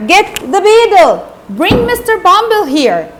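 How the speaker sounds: loud, high-pitched angry order; acted angry shout. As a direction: call out urgently for help, outraged. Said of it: "and he shouted".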